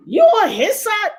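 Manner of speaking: high, loud indignant question. Retold or imitated. Granddaughter protesting to grandmother, high indignant question.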